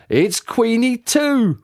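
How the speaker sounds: slow, drawn-out fatherly reveal; lightly voiced dad, pleased; slow proud dad. A narrator's reading.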